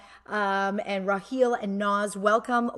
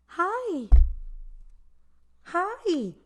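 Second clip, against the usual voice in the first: put-on baby voice; 7 semitones higher than usual. Voicing baby's hi.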